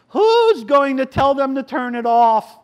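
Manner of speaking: put-on high, loud old lady's yell. Old lady yelling at the passengers about the boom box. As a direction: call out to the whole train car. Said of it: "she yells into the train".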